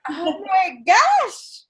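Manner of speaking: high, slow, drawn-out oh my gosh; stunned gasp. Retold or imitated. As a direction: let gosh hang in disbelief. Retelling her own shock after success.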